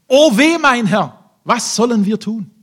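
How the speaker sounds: high, loud panicked wail; frightened servant crying out. Acted. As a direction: cry out in alarm to your master. Servant panics at surrounding army.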